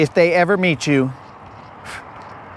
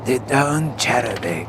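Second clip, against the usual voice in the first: low mock mafia-boss voice; Italian mobster; 4 semitones lower, 4 dB quieter than usual. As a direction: announce it like a mob don. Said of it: "an Italian mobster".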